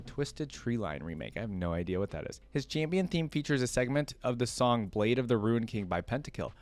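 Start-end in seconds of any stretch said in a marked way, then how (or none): none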